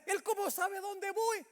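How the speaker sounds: high, drawn-out retold protest; indignant disbelief. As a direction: question it like an offended driver. Acting own indignant reaction back then.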